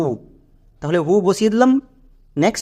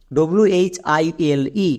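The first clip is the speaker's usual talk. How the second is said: slow spelling out; much slower than usual. Shown as a demonstration. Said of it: "উচ্চারণ কী দাঁড়ালো".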